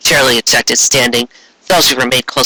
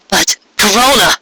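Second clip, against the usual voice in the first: objecting; 5 semitones higher than usual. A narrator's reading. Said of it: "Lyra objected".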